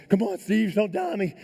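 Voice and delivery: high-pitched urgent begging; desperate pleading. Retold or imitated. Climber rubbing on his dying friend, pleading desperately. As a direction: act out begging a friend to hold on.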